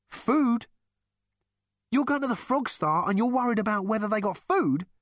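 loud, high disbelief; incredulous. A narrator's reading. Rooster reacts to Zaphod worrying about food, incredulous. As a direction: throw the question back, pitched right up.

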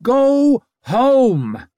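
loud, high warning shout; warning growl. A narrator's reading. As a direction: warn them off. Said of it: "Her voice came out a warning growl".

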